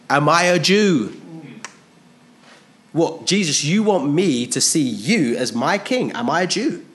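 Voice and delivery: a hammed-up Roman sneering at the idea; angry retort. Pilate angrily rebuffing Jesus' claim to kingship, mock indignant. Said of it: "answered angrily".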